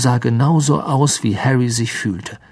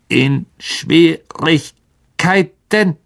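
very slow, higher creature question; worried old spider; 5 semitones higher, much slower than usual. A narrator's reading. Aragog hears Hagrid is in trouble, slow worried creature voice. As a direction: ancient monster gently alarmed. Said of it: "ein wenig Besorgnis".

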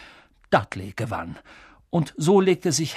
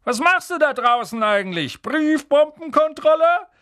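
mocking call; 13 semitones higher, 4 dB louder than usual. Uncle joking to the boy outside, loud and mocking. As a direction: call out your own joke, pleased with it.

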